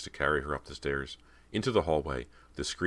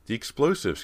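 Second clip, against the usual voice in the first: higher, urgent outburst; higher excited cry; 8 semitones higher, 5 dB louder than usual. A narrator's reading. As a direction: blurt it out with sudden hope. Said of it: "Cody cried".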